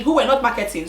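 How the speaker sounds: ordinary talk, nothing marked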